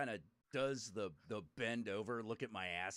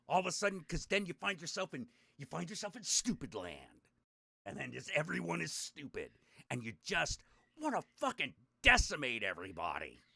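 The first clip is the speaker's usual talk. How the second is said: ordinary talk, nothing marked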